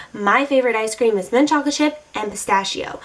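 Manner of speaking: normal talking voice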